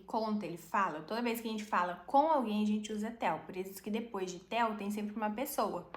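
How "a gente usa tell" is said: ordinary talk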